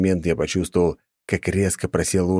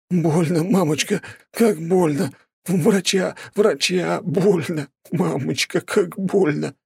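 wailing in pain; 12 semitones higher, slower than usual. A narrator's reading. Wounded viscount cries out.

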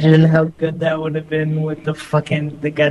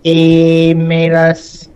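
very slow, spaced robot words; 4 dB louder, much slower than usual. Acted. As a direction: drag each word out mechanically. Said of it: "be the robot".

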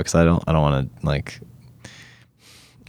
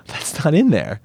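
mock surprise; 8 semitones higher than usual. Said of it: "and I went".

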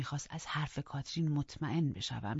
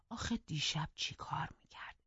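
soft unvoiced whisper; whisper to herself; 5 dB quieter than usual. Housekeeper wondering to herself, whispered. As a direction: breathe the question privately. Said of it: "با خودم گفتم".

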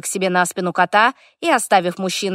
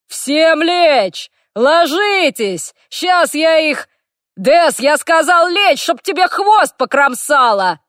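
loud, much higher slow bellow; orc yelling orders; 8 semitones higher, 5 dB louder, slower than usual. A narrator's reading. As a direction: roar at everyone to drop. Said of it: "Харт продолжал орать".

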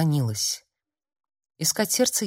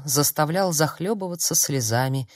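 usual speaking voice

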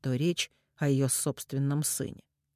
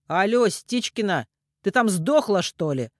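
louder, higher rough call; barked; 5 semitones higher, 6 dB louder than usual. A narrator's reading. Man checks if the woman is still there, barking. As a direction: bark down the line. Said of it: "рявкнул он".

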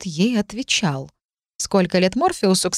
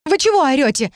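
high-pitched, dumbfounded question; startled, pitch jumping way up; flabbergasted; 8 semitones higher than usual. A narrator's reading. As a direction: ask in stunned disbelief. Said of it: "ошарашенно спросила я".

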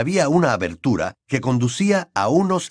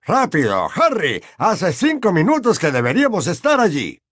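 higher, louder hurried shout; urgent cry; 7 semitones higher, 3 dB louder than usual. Acted. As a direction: yell it in a rush. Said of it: "soltó un grito".

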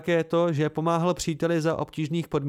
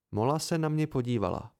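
plain reading voice

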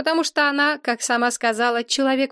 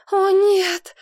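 high, loud theatrical wail; sobbing, acted; 9 semitones higher, 5 dB louder than usual. A narrator's reading. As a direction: wail in hammed-up despair. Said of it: "зарыдала Розамунда". (рыдая, театрально)

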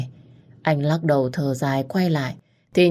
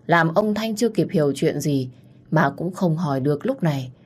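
ordinary delivery

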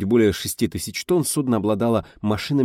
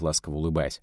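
usual speaking voice

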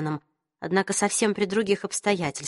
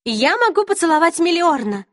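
put-on young woman voice; 9 semitones higher, 5 dB louder than usual. A narrator's reading. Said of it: "предложила Изабель".

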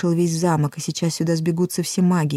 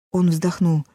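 ordinary delivery, nothing marked

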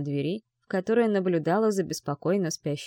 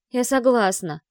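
loud, high, in one breath; blurted out; 5 semitones higher, 6 dB louder than usual. A narrator's reading. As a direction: blurt it out at once. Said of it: "На одном дыхании выпалила Вика".

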